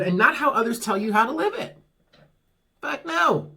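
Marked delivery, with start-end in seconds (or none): 2.8-3.5 emphatic. Real, not acted. Rejecting others' rules.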